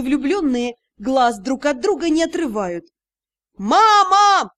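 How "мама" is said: loud high call; shout. A narrator's reading. Son calling mother out to trap the couple, loud. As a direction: yell for mother across the yard. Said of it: "раздался крик Юрау".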